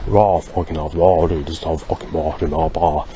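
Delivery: mock a crappy modern gangster accent, bringing the R out; put-on modern gangster accent, R brought out. Shown as a demonstration. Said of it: "accent where they sort of bringing that R out".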